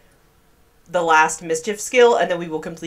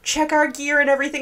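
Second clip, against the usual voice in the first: lightly mocked, higher-pitched voice; imitating sensible hikers; 8 semitones higher than usual. Player mimics other people preparing to hike, lightly mocking high voice. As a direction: gently mimic careful hikers. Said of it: "While other people are like".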